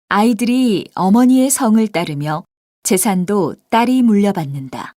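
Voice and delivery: ordinary talk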